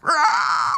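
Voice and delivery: high, loud creature screech. Acted. Struggling shadow creature in the player's grip, screeching. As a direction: let out a piercing monster cry. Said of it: "starting to screech".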